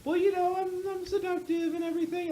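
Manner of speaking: high mock seductress; seductive vampire lady. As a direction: purr it like a vampire temptress. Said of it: "And she's just like".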